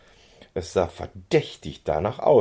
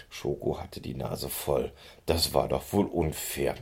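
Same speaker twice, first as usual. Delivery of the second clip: plain reading voice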